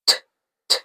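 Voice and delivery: "T, t" as two tiny voiceless tongue taps; T sound. Shown as a demonstration. Teacher showing the T sound, T sound. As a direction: tick each "t" with no vowel. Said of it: "T sound".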